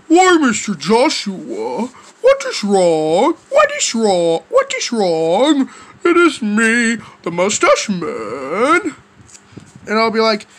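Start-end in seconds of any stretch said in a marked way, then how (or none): 0.1-9.0 low, slow character voice with swooping questions; Mister Mustache Man. Acted. Mustache Man asking Joshua what is wrong, low put-on voice. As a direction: draw words out low, swinging up on questions. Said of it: "Mister Mustache Man will be like to me".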